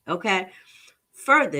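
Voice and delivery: ordinary delivery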